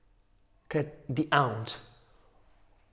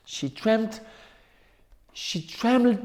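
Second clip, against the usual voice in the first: trembled raised higher, a small shaky rise; higher trembling; 6 semitones higher than usual. A narrator's reading. Narrator lifting She trembled higher to show fear, pitch raised. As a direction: lift She trembled up as if quivering.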